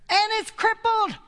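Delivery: high, loud triumphant declaration; exultant. Real, not acted. Preacher rejoicing that the tribulation is crippled, exultant. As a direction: crow it in real triumph. Exultant punchline, laughs right after.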